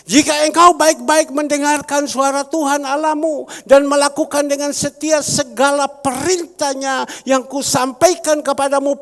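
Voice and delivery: loud, ringing pulpit proclamation; a pastor booming scripture at his congregation; preaching at full force. Acted. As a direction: thunder God's promise to the church. Reading scripture loudly.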